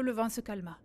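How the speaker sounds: ordinary delivery, nothing marked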